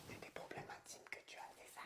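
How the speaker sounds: hushed confidential whisper. Acted. La mère demande à son fils ses problèmes intimes, en chuchotant. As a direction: whisper it discreetly. Mother asks about intimate issue.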